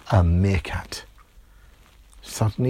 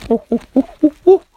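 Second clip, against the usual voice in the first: very high, louder, rising stutter; startled little girls stuck on one sound; stammering girls; 11 dB louder, much faster than usual. Acted.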